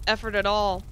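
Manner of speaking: ordinary talk, nothing marked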